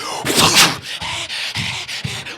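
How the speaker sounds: loud breathy rasping gasp; a wild animal hiss of breath; mock feral huff. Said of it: "He's like".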